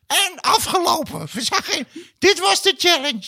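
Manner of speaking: very high, slow mock voice; mocking impression. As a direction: parody the partner, squeaky. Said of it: "En dan zeg ik".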